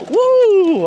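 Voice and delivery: high, loud whoop of joy; excited whoop. Real, not acted. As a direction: whoop it out as the bike lands. Excited whoop on a bumpy ride.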